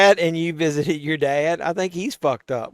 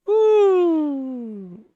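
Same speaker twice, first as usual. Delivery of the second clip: celebratory woo; 11 semitones higher than usual. Real, not acted. Celebrating his own burn joke.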